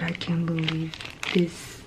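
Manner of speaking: soft ASMR whisper; hushed unboxing whisper. Real, not acted. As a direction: breathe the words close to the mic.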